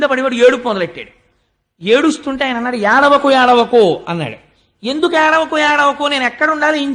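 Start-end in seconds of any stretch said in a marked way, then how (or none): 2.8-4.0 put-on calling out to a wailing baby, loud; loud hushing. Brahma hushing crying newborn Rudra. 4.9-6.9 protesting cry. Acted. Newborn Rudra protests, crying.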